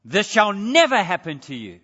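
NEVER hit loud and hard; emphatic NEVER. Acted. Peter protesting to Jesus, emphatic stress on NEVER. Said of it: "It's emphatic".

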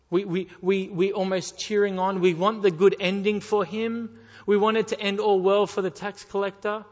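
loud, pleading fire-and-brimstone preaching; full-force preaching. Acted. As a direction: press the congregation hard, loud.

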